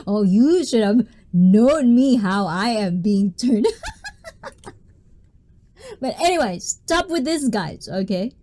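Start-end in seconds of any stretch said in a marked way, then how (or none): 3.7-4.7 squeaky high-pitched giggle; a bright little giggle. Real, not acted. Laughs after joke.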